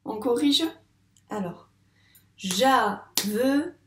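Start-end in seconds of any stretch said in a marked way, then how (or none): 2.4-3.7 "javelot" split ja... ve... lo, loud and slow; teacher's slow ja, ve, lo; syllable by syllable. Shown as a demonstration. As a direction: clap out "javelot" in three separate beats. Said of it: "on tape les syllabes du mot".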